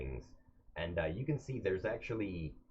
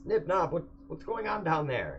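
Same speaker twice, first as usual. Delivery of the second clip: higher, louder call down below; urgent call; 11 semitones higher, 6 dB louder than usual. Acted. Reggie calling down to Nib and Nob, urgent. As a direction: shout down to engineering. Captain calls down to engineers.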